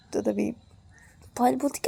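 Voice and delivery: ordinary delivery, nothing marked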